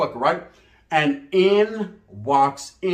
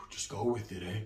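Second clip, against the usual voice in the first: lower, much softer lazy mumble; a hint of a stoned friend; stoned homeboy; 9 semitones lower, 15 dB quieter than usual. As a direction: sound baked and unbothered.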